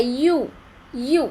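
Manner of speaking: यू said as "yoo", with a y onset; y sound demo. Shown as a demonstration. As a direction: give यू a clear y at the start. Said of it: "लेकिन pronunciation कैसा है".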